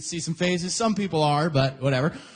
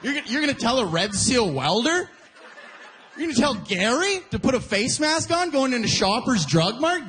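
normal talking voice

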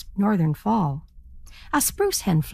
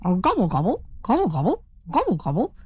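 lightly voiced turkey call, rising; a proud turkey strutting and gobbling; turkey gobble.

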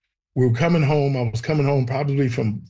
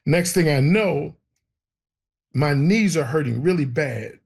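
usual speaking voice

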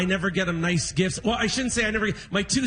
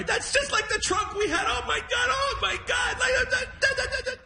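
high, wailing cry through the words; crying dad. Retold or imitated. Imitating his teary dad at Pier 21, wailing high. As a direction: imitate the father sobbing at the trunk.

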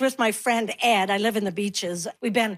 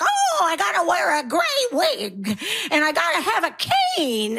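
higher, put-on old lady voice; old lady impression; 5 semitones higher than usual. Young comics performing an old lady, hammed-up. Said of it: "they perform an old lady".

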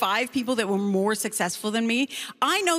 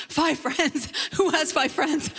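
high, laughing disbelief; hammed-up, pitched-up incredulity; incredulous; 4 semitones higher, slower than usual. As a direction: balk at it as an absurd number. Comic disbelief at the coach's number.

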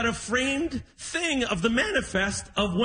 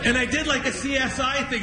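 usual speaking voice

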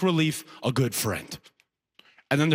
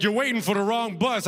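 higher-pitched, confident buddy voice; confident first guy; 6 semitones higher than usual. Acted. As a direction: play the reliable, friendly guy, pitched up. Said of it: "They're like".

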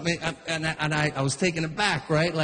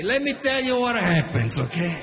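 put-on Italian accent; 6 semitones higher than usual. Dad's Easter story continues.

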